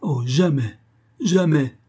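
put-on girl refusing her abductor in despair; desperate refusal. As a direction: refuse him in utter despair. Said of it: "au comble du désespoir".

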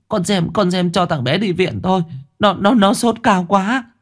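high, breathless pleading in fear; panicked plea. A narrator's reading. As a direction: beg urgently, stumbling over words. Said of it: "Bà rú lên". (van nài hoảng hốt, giọng cao)

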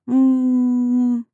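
long steady "mmm"; buzzing hum. A narrator's reading. Narrator likens the letter to buzzing bumblebees, droning hum. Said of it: "а ещё жужжат".

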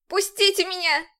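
high, loud cry for release; desperate shout. A narrator's reading. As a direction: scream to be let go. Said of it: "Отчаянно крикнула я".

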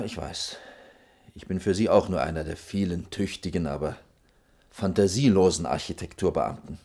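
ordinary talk

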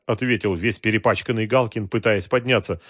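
plain reading voice

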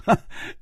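short, breathy chuckle; irrepressible laugh. Acted. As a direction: let a small laugh slip out. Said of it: "也忍不住笑着说". (忍不住轻笑一声)

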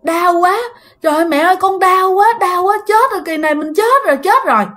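put-on high, pained child's cry for mom. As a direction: whimper for mom in pain and fear. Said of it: "trong đầu của bạn vẫn nghĩ". (đứa trẻ đau đớn kêu mẹ, giọng cao)

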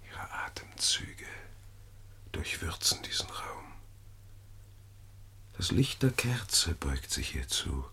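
soft, stealthy whispering by the sleeping woman; hushed whisper. Acted. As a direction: creep close, never wake her. Schleicht nachts ins Schlafzimmer. (leises, lüsternes Flüstern im Dunkeln)

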